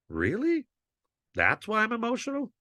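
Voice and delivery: a teacher scoffing at being called too emotional; sarcastic disbelief. Real, not acted. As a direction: pitch shooting way up in mock disbelief. Teacher mocks claim he's too emotional.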